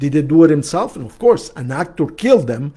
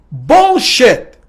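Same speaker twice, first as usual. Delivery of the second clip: louder, higher retold snap; blunt retort; 7 semitones higher, 6 dB louder than usual. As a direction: call it out sharply. Counselor's retold blunt rebuttal.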